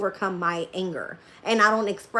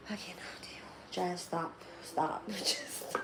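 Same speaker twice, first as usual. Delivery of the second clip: barely voiced, overcome whisper; whispered plea; 3 semitones lower, 13 dB quieter, slower than usual. Real, not acted. Telling Jazz to stop, whispered plea. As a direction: drop to a very soft whisper.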